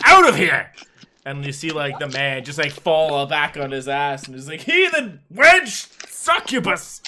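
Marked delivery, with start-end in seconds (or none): 0.0-0.7 loud, high command; shouted order. Acted. Barmaid drives the priest out, shouting loud and high. 4.6-7.0 high, loud, slow outraged shriek; shrieking priest. Acted. Fallen priest shrieks at the barmaid, high, loud and slow.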